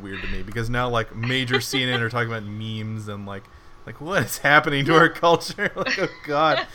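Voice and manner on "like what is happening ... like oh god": loud, high, half-laughing outburst at the news; exasperated disbelief. Real, not acted. Ranting to cohosts about CNN covering memes, exasperated laughing outburst. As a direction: throw up your hands at modern culture.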